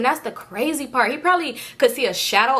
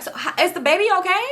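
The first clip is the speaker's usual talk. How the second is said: high-pitched, hesitant question; anxious, halting check; earnest worried asking; 9 semitones higher, faster than usual. Retold or imitated. Driver asking the pregnant woman after crash, earnest worry. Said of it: "I'm really asking".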